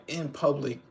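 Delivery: normal talking voice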